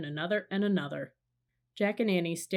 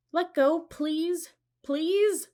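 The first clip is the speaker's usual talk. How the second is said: high, slow, louder pleading; pleading shout; 10 semitones higher, 5 dB louder, much slower than usual. A narrator's reading. Annie begs the octopus to release the sub, slow high pleading shout. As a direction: beg the octopus to let go. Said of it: "Annie shouted at the octopus".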